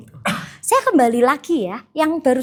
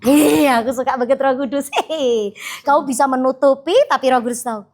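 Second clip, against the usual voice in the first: ordinary talk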